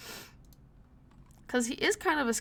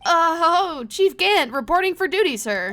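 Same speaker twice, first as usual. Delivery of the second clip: high, loud, startled report; flustered salute; 7 semitones higher, 11 dB louder than usual. Acted. Lawyer suddenly reporting for duty to the chief, flustered. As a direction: snap to attention in a raised voice.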